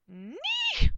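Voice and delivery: very high piercing yelp; loud comic falsetto squeal; shrieked knight's cry. A narrator's reading. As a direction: shriek it as a sudden attack. Knight yells his magic word.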